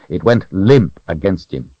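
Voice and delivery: usual speaking voice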